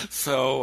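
hearty burst of laughter; loud laugh. Real, not acted. Laughing at the story.

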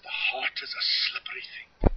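put-on light Yorkshire accent, whispered. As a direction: quote a Yorkshire friend softly, whispered. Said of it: "thick Yorkshire accent".

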